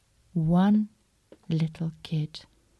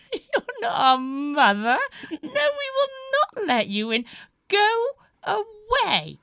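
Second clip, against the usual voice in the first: little goats' voice; 13 semitones higher, 5 dB louder than usual. Acted. Little goats refusing wolf.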